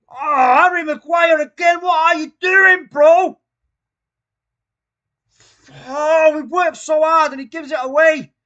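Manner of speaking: roaring in disbelief at the goal; furious shout. Real, not acted. Commentator shouts at the conceded goal, furious. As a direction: bellow it out, livid and loud.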